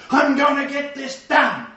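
loud, high, slow angry vow. Retold or imitated. Marcus pushing himself to finish his assessment, shouting high and loud. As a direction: shout it like you mean to finish the job. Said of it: "He has to get angry and go".

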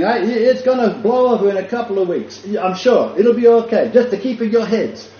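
high, loud nervous soldier; a shaken guard insisting it will blow over; mock-brave guard voice. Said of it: "he's saying".

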